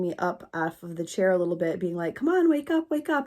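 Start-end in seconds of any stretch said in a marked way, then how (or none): 2.1-3.3 mock urgent rousing of someone who fainted; urgent coaxing. Coworker tries to rouse the fainted supervisor, high urgent coaxing.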